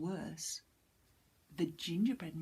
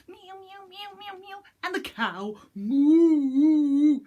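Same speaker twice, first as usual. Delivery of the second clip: high meows then a loud moo; playful cat and cow sounds; animal noises; 11 semitones higher, 16 dB louder than usual. Acted. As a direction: meow and moo for the children.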